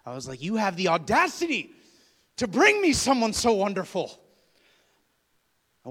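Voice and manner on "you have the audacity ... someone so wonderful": shouted high and loud, hammed-up outrage; mock fury at God. Man to God, hammed-up furious shout. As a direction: yell at God in comic indignation. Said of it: "I was furious at God".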